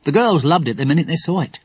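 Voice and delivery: usual speaking voice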